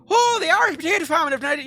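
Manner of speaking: high, loud mock-Irish jeer; mocking Irish accent. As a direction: taunt loudly in a hammed-up Irish accent. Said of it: "mocking me in an Irish accent going".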